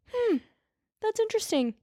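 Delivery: mock suspicious hmm. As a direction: pitch jumps way up on a sarcastic that's interesting. Police react to obvious lie.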